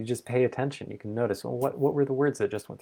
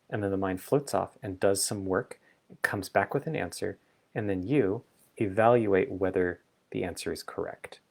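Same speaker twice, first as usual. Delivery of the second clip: ordinary talk, nothing marked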